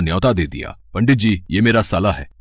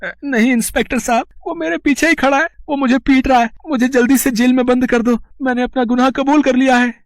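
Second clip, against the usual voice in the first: much higher, breathless panic to the inspector; frantic plea. Acted. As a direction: cry out for rescue in terror. Insists Hanuman is beating him.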